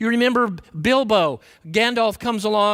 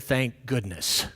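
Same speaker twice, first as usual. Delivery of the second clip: Bilbo's contented sigh; 9 semitones lower, 6 dB quieter, slower than usual. Acted. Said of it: "and said".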